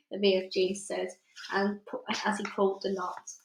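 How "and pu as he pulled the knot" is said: plain reading voice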